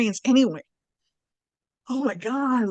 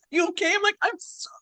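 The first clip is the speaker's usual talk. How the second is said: high, squeaky mock-tearful babble; imitated crying and laughing; 9 semitones higher than usual. Retelling crying in partner's arms, imitated tearful giggle. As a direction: act out tearful giggling, pitched way up. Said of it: "I'm, like, crying and laughing and".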